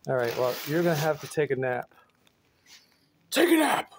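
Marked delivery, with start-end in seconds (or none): 3.3-3.9 squeaky high command to the pet; high sing-song. Real, not acted. Tells the pet to nap.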